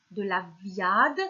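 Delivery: VIADE drawn out slowly, with an open ya; wrong-word option. Shown as a demonstration. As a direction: stretch VIADE with ya and no nasal. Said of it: "on dit de la viande". (« viade » étiré lentement, avec un « ya » sans nasale)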